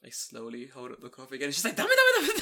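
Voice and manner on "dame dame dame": very high, loud wife impression; shrieked mock scolding; mock angry scolding. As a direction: imitate an outraged wife's rapid telling-off. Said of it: "They're both so angry at me".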